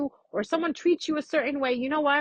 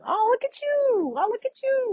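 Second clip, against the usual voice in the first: gushing high; 12 semitones higher than usual. Retold or imitated. To a friend, high delighted cooing. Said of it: "We're more like this".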